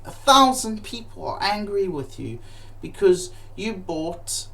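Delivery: ordinary talk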